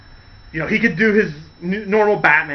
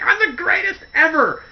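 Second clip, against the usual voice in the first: overjoyed boast; 12 semitones higher than usual. Retold or imitated. Riddler brags after unmasking Batman, overjoyed and crowing. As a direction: crow it, higher and gleeful.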